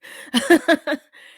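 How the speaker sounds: sudden high laugh, breaking out; burst of laughter. Real, not acted. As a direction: laugh it off bitterly, sharp and high. Laughs while recalling expulsion.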